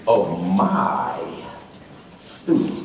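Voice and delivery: "my" stretched out and hushed; stress on "my". Shown as a demonstration. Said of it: "I put that emphasis on this word".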